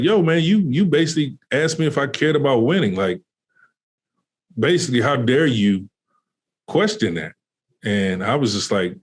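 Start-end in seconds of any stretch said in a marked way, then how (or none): 0.0-7.3 upset player. Retold or imitated. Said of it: "He was like".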